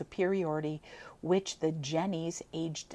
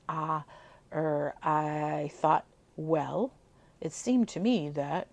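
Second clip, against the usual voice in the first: hesitant; 4 dB louder, slower than usual. A narrator's reading. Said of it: "again he came to a period".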